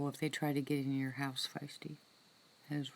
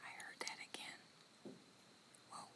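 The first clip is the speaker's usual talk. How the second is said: hushed whisper, straining to hear; 2 semitones lower, 19 dB quieter than usual. Real, not acted. Streamer hearing noises outside at night: tense whisper. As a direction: whisper as if something may hear you.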